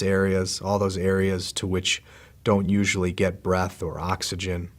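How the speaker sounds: soft, unhurried meditation voice with long pauses; calm, hushed body-scan guidance; guided meditation. Real, not acted. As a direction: guide them gently into relaxing, leaving space after each phrase.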